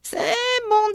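a sour little witch, mocking; shrill spiteful witch. A narrator's reading. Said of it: "dit-elle". (voix de sorcière pointue, aigre et méchante)

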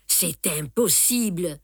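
ordinary delivery, nothing marked

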